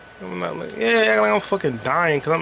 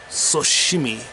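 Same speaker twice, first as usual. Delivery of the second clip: ordinary talk, nothing marked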